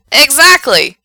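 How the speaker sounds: loud, high burst of agreement; emphatic agreement. Acted. Gerard agrees with a fellow fan, loud and excited. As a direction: jump on it with delight.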